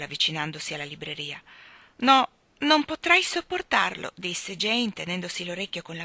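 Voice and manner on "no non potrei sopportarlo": high, loud, slow refusal; pained protest. A narrator's reading. Sick Jane refusing a story from Michael, pained protest. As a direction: protest as if it hurts.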